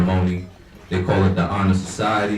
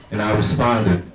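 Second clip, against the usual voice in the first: ordinary delivery, nothing marked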